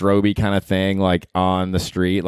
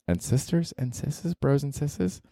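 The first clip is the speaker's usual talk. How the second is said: playful aside; 5 semitones higher, 8 dB quieter, slower than usual. Real, not acted. Affectionate shout-out to listeners.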